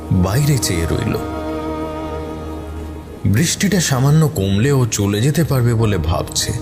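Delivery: ordinary delivery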